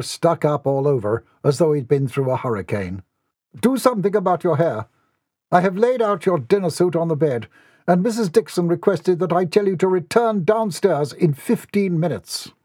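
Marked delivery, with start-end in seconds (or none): 3.6-12.5 higher-pitched, formal butler voice; butler giving orders. Acted. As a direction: instruct the young master formally. Butler instructing the young master.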